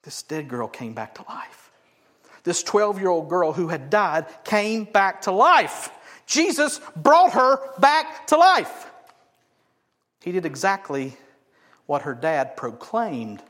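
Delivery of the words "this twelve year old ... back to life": slow, loud preaching, building higher; emphatic proclamation. Real, not acted. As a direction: land each word with full force. Preacher climaxes on the miracle.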